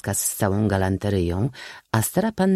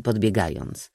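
usual speaking voice

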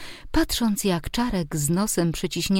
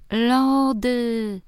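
put-on high, drawn-out little-boy wail; long childish howl of demand; child's tantrum; 5 semitones higher than usual. A narrator's reading. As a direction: cut in stubbornly as a demanding five-year-old. Child repeats his tantrum demand.

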